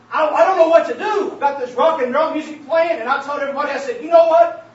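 loud, fervent sermon climax; preaching at full force. Real, not acted. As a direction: drive it home to the congregation.

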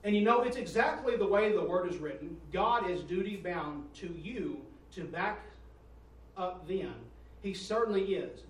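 loud, slow, booming preacher read; preaching at full force. Acted. Preacher booming a quote to his church. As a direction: thunder the quote out to the congregation.

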